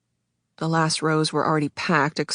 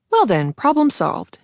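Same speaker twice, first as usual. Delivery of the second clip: cheerful guy; 5 semitones higher, 4 dB louder than usual. Acted.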